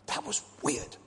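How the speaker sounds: whispered, as if sharing a secret; light mock-spooked whisper; hushed spooked aside. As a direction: hush it like something uncanny happened. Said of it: "they say".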